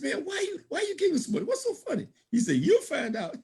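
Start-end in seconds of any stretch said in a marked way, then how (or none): 0.0-2.1 high-pitched, incredulous question with laughter in it; baffled questioning. Retold or imitated. Speaker retells quizzing the giggling man, high and baffled. 2.6-3.4 giggling. Retold or imitated. Big man in the corner answering the curious speaker, giggling. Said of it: "and he's just giggling".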